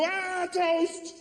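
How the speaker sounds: high mock shriek of fright; scared kid. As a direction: shriek at the toast like a terrified child. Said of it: "They go".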